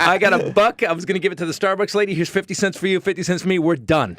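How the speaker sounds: high, bright, breezy mock generosity; sing-song sarcastic send-off; mock cheerful husband. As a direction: pay her off with a cheery smirk. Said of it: "you go".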